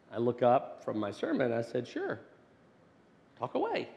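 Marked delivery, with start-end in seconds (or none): 1.9-3.9 lifted high, airy and offhand; breezy dad reply. Retold or imitated. Father answering his son breezily, voice lifted high. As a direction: toss it off lightly, much higher.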